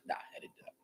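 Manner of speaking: soft, unvoiced denial; whispered aside. Real, not acted. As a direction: murmur it as a sheepish joke.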